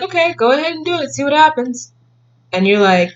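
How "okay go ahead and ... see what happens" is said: high, fast, breezy permission; careless grandparents. Retold or imitated. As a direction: shrug it off, let them try. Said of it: "they'd be like".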